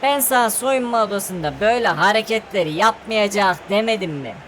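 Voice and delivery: ordinary talk, nothing marked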